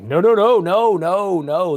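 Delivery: retold panicky refusal, easing off as it goes; alarmed refusal. New Bible teacher turning down his pastors' preaching offer, alarmed refusal.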